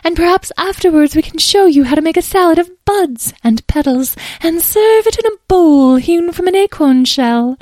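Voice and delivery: very high, loud fairy voice; eager, lofty little fairy; gracious fairy. Acted.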